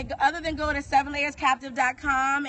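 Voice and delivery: ordinary delivery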